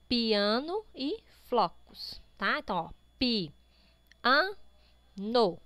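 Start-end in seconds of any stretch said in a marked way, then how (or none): 3.2-5.6 pi, an, no with a clear pause after each piece; syllable by syllable. Shown as a demonstration. Teacher counts mouth openings for pupils, syllable by syllable. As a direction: open up separately for pi, an, no. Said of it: "Quantas vezes você abre a boca".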